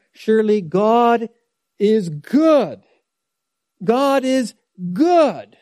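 "good" punched high and loud, with a falling tone; stress on good. Shown as a demonstration. Preacher reading the psalm's first line, stress on "good". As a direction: lean hard on "good" as the key word. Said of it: "The emphasis is on good".

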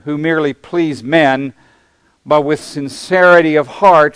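preacher reading scripture from the pulpit. Acted. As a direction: read it out like holy writ to the congregation. Reads scripture passage aloud.

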